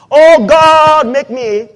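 a pretend beggar at prayer, shouting; loud mock plea to heaven; mock pleading prayer. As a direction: cry out loud and high to God, hammed-up. Mock prayer asking for things.

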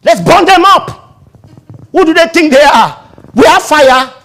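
hammed-up rage, ready to burn; mock angry disciples. Disciples begging Jesus to burn the town, furious. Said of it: "Just permit us to call fire".

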